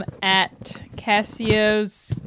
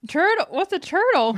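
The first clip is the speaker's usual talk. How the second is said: surprised; 8 semitones higher than usual. Real, not acted. Mishearing churtle as turtle.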